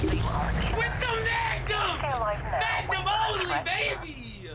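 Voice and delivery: high, slow hype yell; excited shout. Real, not acted. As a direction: crow it out, thrilled. Hype over game kill.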